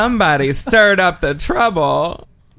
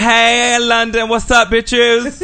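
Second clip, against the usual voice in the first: mock Londoner greeting; 4 semitones higher, slower than usual. She imitates a friend shouting hello to London, mock brash. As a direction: hail London like a brash local. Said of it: "She's like".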